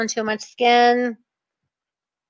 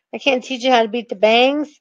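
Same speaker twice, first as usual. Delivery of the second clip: ordinary talk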